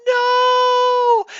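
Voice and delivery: high, piercing loud no; terrified scream. Pretend. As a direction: shriek in horror. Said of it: "They gasped".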